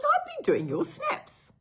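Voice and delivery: put-on high, slow, proud old lady; Ma's voice. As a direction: put on her pleased friend's voice. Voicing Ma in phone chat.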